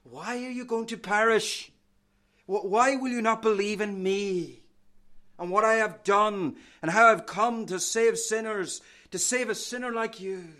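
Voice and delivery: pleading Christ voice. Acted. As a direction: plead with outstretched arms. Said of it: "he says to you with outstretched arms".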